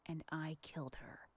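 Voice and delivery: breathy menacing whisper. Acted. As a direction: whisper the confession like a threat. Creepy caller ends in whisper.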